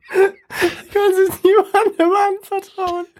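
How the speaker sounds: high, slow, laughing through tears; stifled giggles. Real, not acted. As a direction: try to read but crack up. Tries reading, laughing.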